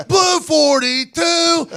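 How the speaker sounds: high, drawn-out snap call; loud stretched play call; quarterback cadence. Acted. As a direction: holler it out like a quarterback. Acting out calling the snap.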